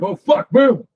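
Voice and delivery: a quick, high startled burst; startled gunfight exclamation. Retold or imitated. Retelling his gunfight reaction to the host, startled high burst. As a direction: blurt it out like you've just been surprised mid-fight.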